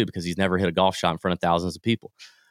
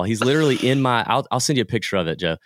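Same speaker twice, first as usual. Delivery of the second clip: ordinary delivery, nothing marked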